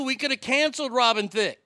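ordinary talk

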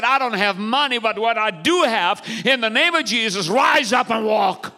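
high preaching cry building to a shout; rousing proclamation. Acted. Peter commands the lame man to walk, rousing shout.

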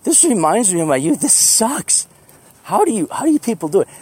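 groggy whining. Retold or imitated. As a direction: moan it like a miserable morning person. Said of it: "I'm like".

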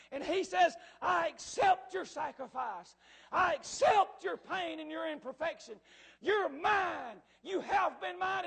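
loud, ringing sermon climax; impassioned preaching. Acted. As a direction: proclaim God's acceptance with full force. Preaching at full force.

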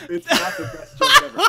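loud, high objection through laughter; laughing protest. Real, not acted. Co-host disputes the best joke ever, laughing loudly. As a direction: protest while cracking up.

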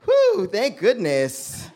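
slow, high mock sigh of relief. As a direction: drag out the relief like a saved man. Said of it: "So I was like".

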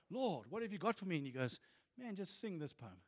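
hushed back-and-forth with the Lord; soft prayer dialogue. Retold or imitated. Dialogue with God voiced softly.

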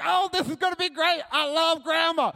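put-on high, loud kid excitement; overjoyed child. Child offered food by Grandma, overjoyed. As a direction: gush at full volume.

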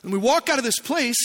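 usual speaking voice

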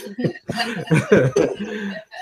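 real amused belly laugh. Real, not acted. Host cracking up at the guest's confession, long laugh.

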